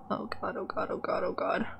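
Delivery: real anxious whisper, very soft; nervous whispering. Real, not acted. Player fretting over a risky choice, nervous whisper. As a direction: whisper it under your breath in dread.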